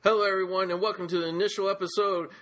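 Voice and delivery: rushed announcer intro; speeding up. Shown as a demonstration. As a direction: rattle off the intro too fast. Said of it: "You're going too fast".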